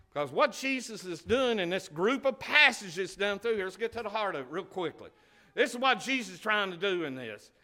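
loud, high fervent preaching. Acted. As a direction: preach it out to the church with fire. Preaching at full force.

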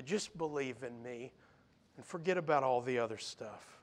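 low, hushed, tender plea; soft, intimate reassurance from the pulpit; gentle appeal. Acted. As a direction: drop right down and coax softly. Preacher voices Jesus gently appealing.

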